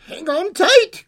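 high, loud, stretched-out call; creature's cry. Acted. Creature warns girl as they take off, high drawn-out cry. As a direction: shout it over the rushing air. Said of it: "cried the creature".

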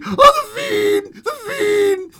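high, loud, crying shrieks of terror; frenzy. Acted. Student realizing his bride is a corpse, frenzied shouting. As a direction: scream it out, losing his mind. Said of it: "burst into a frenzy".

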